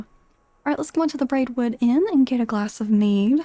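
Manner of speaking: normal talking voice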